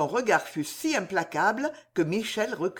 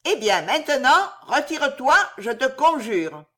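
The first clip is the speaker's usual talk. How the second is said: high, loud crone command; the old witch sends her away; high loud conjuring crone; witch ordering; 6 semitones higher, 5 dB louder than usual. Acted.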